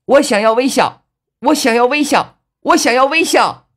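very high, loud repeated yell; nonstop loud shouting. A narrator's reading. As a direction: keep hollering it without letting up. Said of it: "不住口的大声喊道".

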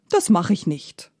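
ordinary delivery

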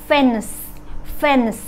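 "fence" ending on a long hissed S; final S hiss. Shown as a demonstration. Teacher showing learners the word's ending, final S hissed. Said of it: "เพราะฉะนั้นเวลาออกเสียงออกเสียงเป็น".

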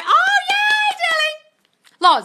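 very high, loud squeal of delight; thrilled, high-pitched whoop; excited cheer. Real, not acted. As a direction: squeal a drawn-out congratulation. Cheering a viewer's happy news.

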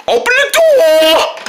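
high, loud, slow bark; loud slow angry command; angry demand. Acted. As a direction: order it furiously.

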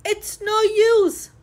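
out of breath from chasing; panting. A narrator's reading. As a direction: gasp it out after a chase.

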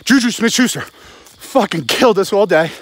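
usual speaking voice

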